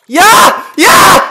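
loud high cheer; triumphant shout. Real, not acted. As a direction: scream with joy. Gamer wins, cheering.

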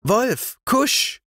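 put-on high child's call to the dog; girl calling a barking dog out of the cave, high. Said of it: "rief sie hinaus".

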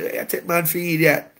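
put-on joking Jamaican accent. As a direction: play an indignant husband in Jamaican patois. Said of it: "in his Jamaican accent would say".

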